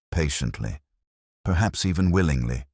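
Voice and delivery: ordinary talk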